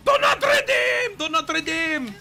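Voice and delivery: very high shouted parody Indian scammer accent; mock "Canadian accent". Shown as a demonstration. Streamer demonstrating the "Canadian accent" joke, shrieked Indian-accent parody. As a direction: yell it in a hammed-up Indian call-centre accent. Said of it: "this is the Canadian accent".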